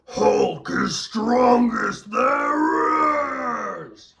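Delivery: slow, high bellowing Hulk shout. Retold or imitated. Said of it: "as good as I could do for the Hulk".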